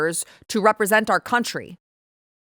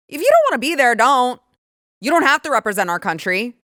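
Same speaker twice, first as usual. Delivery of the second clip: high, loud exasperation; real indignation, pitched up and loud; indignant outburst; 5 semitones higher, 5 dB louder than usual. Real, not acted. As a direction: tell him off, voice raised. Angry rant at the athlete.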